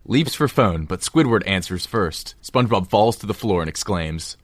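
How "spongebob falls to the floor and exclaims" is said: ordinary talk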